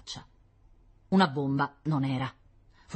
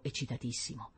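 ordinary delivery

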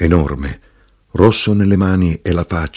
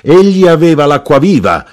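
high, loud tavern outburst; shouted; 10 semitones higher, 8 dB louder than usual. A narrator's reading. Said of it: "gridò l'arrotino".

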